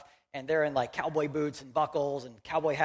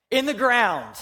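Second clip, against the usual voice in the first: louder and higher, voicing the crowd's answer; mock confident shout; 6 semitones higher, 6 dB louder, slower than usual. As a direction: belt it out like a sure congregation. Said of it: "they confidently shouted".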